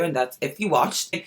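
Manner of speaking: normal talking voice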